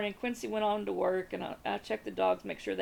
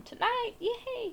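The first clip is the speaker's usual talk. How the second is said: gleeful yay; much slower than usual. Real, not acted. Wife glad husband is away.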